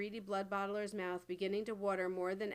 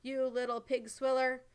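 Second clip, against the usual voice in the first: louder, higher angry roar; 4 semitones higher, 6 dB louder than usual. A narrator's reading. Giant raging at the BFG, angry roar. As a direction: bellow the insult at him. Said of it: "roared the blood bottler".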